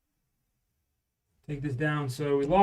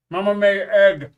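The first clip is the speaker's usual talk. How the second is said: talking through a mouthful; words around a bite of food; mouth full; 4 semitones higher, 8 dB louder than usual. Real, not acted. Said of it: "getting yelled at for talking with my mouth full".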